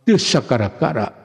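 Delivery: ordinary delivery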